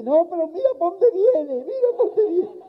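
high, scornful sing-song voice; mock it like a gossiping neighbour; high mocking put-on voice. Mocking voice in joke opener.

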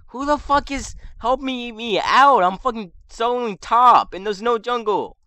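furious shout at teammates; angry yelling. Real, not acted. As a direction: yell it out in frustration. Raging at teammates mid-game.

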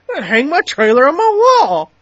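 high, loud sarcastic mimicry; mocking voice. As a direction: play a fool bragging about the wall. Mocking the product idea.

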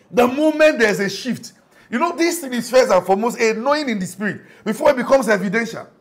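loud, high preaching climax; fiery preaching. Acted. Pastor stressing a spiritual shift to the church, loud high preaching. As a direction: drive it home.

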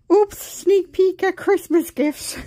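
high, slow, sing-song oops; playful surprise. Real, not acted. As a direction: caught out, teasing the viewers. Caught showing hidden gifts.

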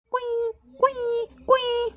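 very high, slow, thin beeping squeaks; squeaky phone beep. Acted. Mimicking the phone hanging up, high squeaky beeps. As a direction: squeak out the dead line tone like a cut phone.

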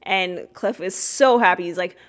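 usual speaking voice

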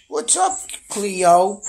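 cute high greeting for the kitty; greeting the cat. Real, not acted. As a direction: squeak it up high to her.

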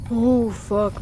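loud, startled swear; frightened curse. Real, not acted. Player scared by llama, frightened curse. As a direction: blurt it loudly after a scare.

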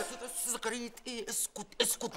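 urgent hushed shush. Acted. Boss hushing his worker so no one hears his name, hushed. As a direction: hush him fast so no one hears.

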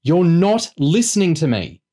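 insistent yell, pitched well up; exasperated yell. A narrator's reading. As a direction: yell it down the phone, fed up. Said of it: "Chloe yelled".

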